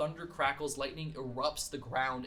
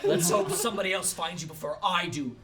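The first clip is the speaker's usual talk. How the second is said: ordinary delivery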